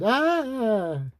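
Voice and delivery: high startled scream; a high, sharp cry of fright. A narrator's reading. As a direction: shriek as the door slams.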